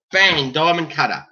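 normal talking voice

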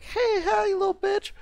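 put-on very high squeaky taunt of a young gamer; squeaky little kid. Kid on Xbox Live taunting a stranger, shrill squeaky voice. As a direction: squeal it like a twelve-year-old trash-talker. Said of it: "You do sound like a little kid".

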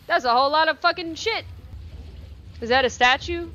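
ordinary talk

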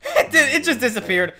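talking through laughter; laughing. Real, not acted. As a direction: laugh while you say it. Laughing at glitch.

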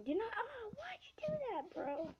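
high, airy disbelief; high whispered protest at the game; whispered dismay. Real, not acted. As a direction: gasp it out in disbelief.

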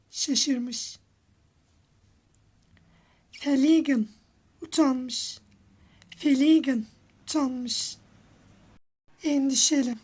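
ordinary delivery

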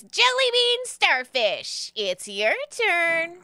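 slow, high announcer-like pixie call; Jeremy the pixie. Acted. Pixie calls the next person up, high and drawn-out. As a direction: sing out high, stretching the words. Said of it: "Jeremy looks at Liam and goes".

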